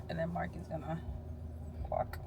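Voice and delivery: whispered teasing aside; whispering. Real, not acted. Unvoiced aside about Mark.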